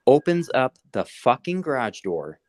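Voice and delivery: normal talking voice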